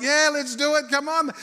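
high, loud acted cheer; rally your mates to join in; gung-ho and excited; youthful enthusiasm. Friends eagerly agree to plan.

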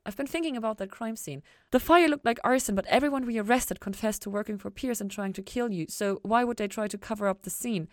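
Ella working out the arson puzzle, excited. Acted. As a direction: press him with eager theories. Ella questions Lucifer excitedly.

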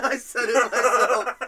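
high, loud, slow words through laughter; laughing hard. Real, not acted. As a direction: barely get it out for laughing. Host cracks up at own slip.

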